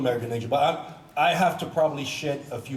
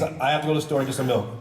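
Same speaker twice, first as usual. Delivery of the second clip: normal talking voice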